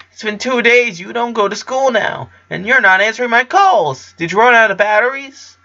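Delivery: put-on high, eager boy's voice; excited. A narrator's reading. Clyde greeting his missing best friend, excited and high. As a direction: burst out with glad surprise.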